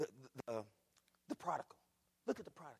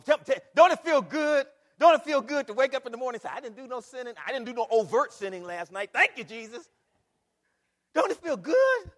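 shouted, much higher and louder praise; joyful full-force preaching; 5 semitones higher, 15 dB louder than usual. Acted. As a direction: lift the church with joy.

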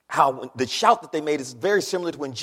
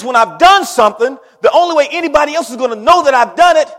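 loud, ringing sermon delivery, much louder; preaching at full force; 7 semitones higher, 14 dB louder than usual. Acted. Preacher rouses congregation about God's work, full-force preaching. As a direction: preach it out to the whole room.

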